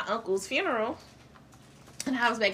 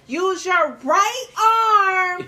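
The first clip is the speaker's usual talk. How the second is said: exasperated dance teacher over Zoom; dance teacher instructing; 8 semitones higher, 5 dB louder than usual. Shown as a demonstration. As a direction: coach the class through the move over Zoom. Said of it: "So me going up here like".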